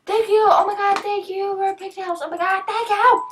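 high, loud gushing; gasp, then gush at high pitch; excited thanks. Real, not acted. Streamer thanking viewer for a gift, high and thrilled.

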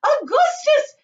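very high, loud shriek; shrill motherly yell of the name; mother crying out. A narrator's reading. Mother calling her son, crying out shrilly. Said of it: "cried Missus Gloop".

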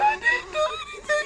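high, hammed-up wailing sob; mock crying. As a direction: wail it like a fake crybaby. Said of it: "He said".

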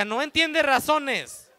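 ordinary delivery

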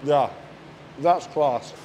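talking while chewing; mouth full of bread. Real, not acted. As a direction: hand over full mouth. Seen 0: Just-bitten bread; hand covers mouth, jaw working while talking.

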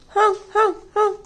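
high, loud honks; mock seal honk for children. Seal honking in story.

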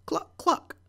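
high clucking hen noise. A narrator's reading.

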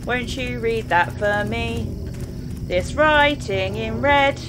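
slow, drawn-out sing-song pleading; playful coaxing. Pretend. Gamer coaxing Eileen to read writing, playful sing-song.

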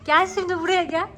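high, loud come here now; loud high call to a cat. Real, not acted. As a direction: call the cat over firmly. Coaxing cat loudly.

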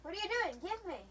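whining demand, pitched well up; high-pitched protest. Real, not acted. Demanding an item from a teammate, high-pitched protest.

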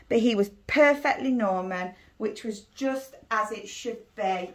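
put-on lively read-aloud for young children; teacher reading a storybook to her class; story narration. A narrator's reading. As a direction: read it like a bedtime picture book. Reads picture book to pupils.

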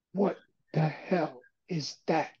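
spaced-out awe, imitating amazed listeners; stunned disbelief. Crowd hearing the new song in an empty stadium, stunned.

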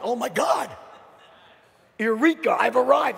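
loud, jubilant "Eureka"; ecstatic exclamation. Retold or imitated. The young salesman, realising his gift, exclaims loudly to himself. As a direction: burst out like a discovery.

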